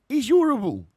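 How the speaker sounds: high, loud, hammed-up Cockney; bad Cockney accent. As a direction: push the mock Cockney right over the top. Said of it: "I can't do a Cockney accent".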